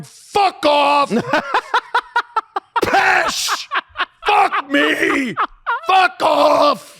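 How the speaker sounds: high Scottish football yell; Scottish fans yelling. Retold or imitated. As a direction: bellow like an angry Scottish terrace crowd. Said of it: "Scottish people going".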